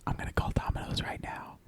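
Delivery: conspiratorial whisper. Real, not acted. Whispering his prank plan to cohost. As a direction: plot the prank under your breath. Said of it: "whisper unvoiced".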